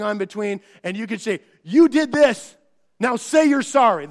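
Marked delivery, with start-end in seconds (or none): 1.6-4.1 louder, higher scolding parent; stern parent. Retold or imitated. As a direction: order the child to say sorry. Parent ordering child to apologize.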